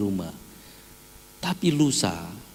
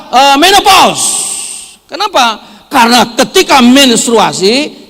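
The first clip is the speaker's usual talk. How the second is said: loud, high, fiery sermon delivery; full-force preaching; 12 semitones higher, 16 dB louder than usual. Acted. Pastor loudly exhorts husbands to accept their wives, preaching hard. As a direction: preach it out to the whole hall.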